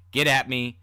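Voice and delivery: plain reading voice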